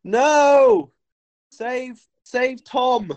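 high, hammed-up plea; mock desperate cry. English guy hanging off the cliff, mock desperate plea. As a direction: cry out high, then plead. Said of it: "the English guy goes".

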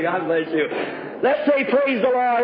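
usual speaking voice

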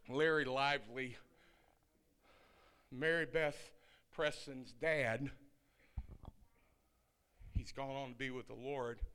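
ordinary talk, nothing marked

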